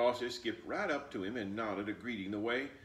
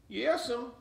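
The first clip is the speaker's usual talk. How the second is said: put-on much higher, curious child voice; little girl asking; 7 dB louder than usual. Flossie asking her grandmother about the fox, high and curious. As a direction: ask like a puzzled little girl. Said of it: "Flossie answered".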